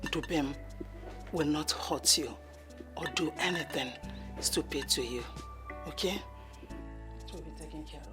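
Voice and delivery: soft, low comforting; soothing. Acted. Mother comforts her crying daughter, softly. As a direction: hush her gently.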